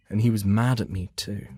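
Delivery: usual speaking voice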